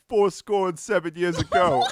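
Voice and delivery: high mock holler of a quotation; hammed-up old man's heckle; imitated old heckler. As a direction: repeat the joke as the old guy, voice way up. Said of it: "same guy stood up and went".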